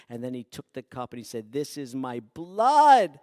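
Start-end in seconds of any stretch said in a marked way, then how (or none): 1.5-3.2 solemn loud declaration. Acted. Jesus at the last supper.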